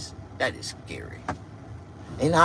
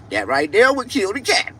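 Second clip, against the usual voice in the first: bragging punchline; 8 semitones higher, 5 dB louder than usual. Acted.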